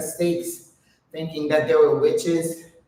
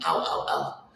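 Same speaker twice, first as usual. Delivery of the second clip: pained groan; 7 dB quieter than usual. Real, not acted. Straining in a squat hold.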